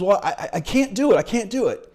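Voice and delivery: a hint of a whiny, defeated Luke; despairing. Luke giving up in front of Yoda, stammering despair.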